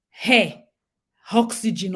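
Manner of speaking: "hair" and "oxygen" with the Yoruba added H; Yoruba H factor. Shown as a demonstration. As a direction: put an H before the vowel in "hair" and "oxygen". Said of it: "sounding with H factor".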